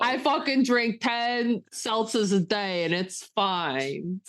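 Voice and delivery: slow, gruff mogul impression, lightly voiced; Harvey Weinstein impression. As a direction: drawl it out like a swaggering movie mogul. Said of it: "I'm talking to Harvey Weinstein".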